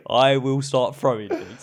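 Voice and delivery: slow, loud threat. Retold or imitated. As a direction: threaten it slowly and loudly. Uncle repeating threat.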